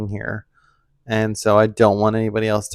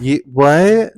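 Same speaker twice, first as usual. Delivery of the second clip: surprised; 5 semitones higher, 8 dB louder than usual. Pretend. Mock shock at forgetting.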